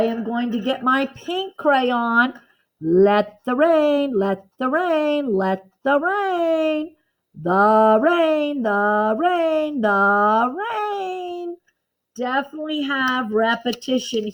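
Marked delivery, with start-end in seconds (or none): 2.8-11.6 repeated phrase stressed for the class; stress on repeats. Shown as a demonstration. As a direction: lean on rain each time it comes. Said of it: "I sure see repetition".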